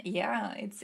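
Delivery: usual speaking voice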